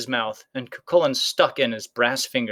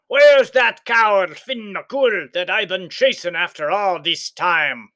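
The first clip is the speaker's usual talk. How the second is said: loud, high, slow bully of a giant; blustering giant; 6 semitones higher, 4 dB louder, slower than usual. A narrator's reading. Giant demanding Finn at the door, slow loud bluster. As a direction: boom it out, slow and menacing. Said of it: "bellowed the giant".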